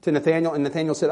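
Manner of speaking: ordinary delivery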